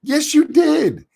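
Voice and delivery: very high, drawn-out contradiction; high, slow, indignant comeback; exasperated rebuttal. Acted. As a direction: throw the denial back at him, stretched out high. Arguing with the quoted denial.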